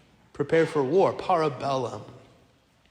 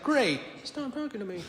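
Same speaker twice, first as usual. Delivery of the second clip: sarcastic aside; 8 semitones higher, 5 dB quieter than usual. Retold or imitated. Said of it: "And I'm just like".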